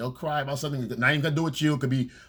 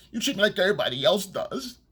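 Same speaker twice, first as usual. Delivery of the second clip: mock victim whine; 7 semitones higher than usual. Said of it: "ultimate victim card".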